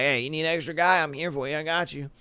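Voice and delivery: low, soft, quick offer; mock-casual volunteer. Speaker offering himself as the extra guy, mock-casual. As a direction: play at offering yourself on the quiet. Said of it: "I'd be like".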